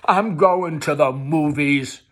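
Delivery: slow, loud and pointed; defiant. Acted. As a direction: throw it in her face. Defiant reply.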